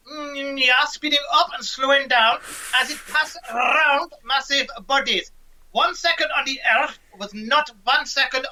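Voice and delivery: high put-on Einstein voice with German Und; Einstein impression. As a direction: play old Einstein, pitched well up. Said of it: "impressed with your impression".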